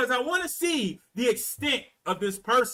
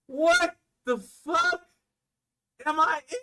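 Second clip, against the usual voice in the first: high, drawn-out incredulous plaint; appalled disbelief; 7 semitones higher, slower than usual. Acted. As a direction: stretch it out in aghast bewilderment. Acted dismay during the call.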